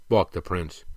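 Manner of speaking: ordinary delivery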